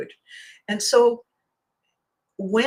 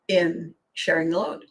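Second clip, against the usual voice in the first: ordinary talk, nothing marked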